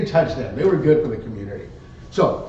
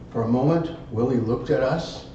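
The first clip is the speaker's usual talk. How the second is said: normal talking voice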